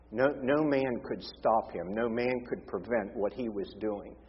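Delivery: ordinary talk, nothing marked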